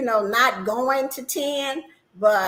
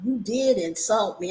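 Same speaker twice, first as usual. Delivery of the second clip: usual speaking voice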